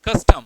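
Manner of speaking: Custom punched loud on कस; stress on first syllable. Shown as a demonstration. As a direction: emphasise कस in custom, weaken टम. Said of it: "हम emphasise करते हैं custom".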